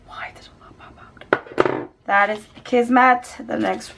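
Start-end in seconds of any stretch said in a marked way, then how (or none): 0.1-1.9 puzzled little whisper. Real, not acted. Puzzled by the lipstick mishap, whispering. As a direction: whisper the question to yourself.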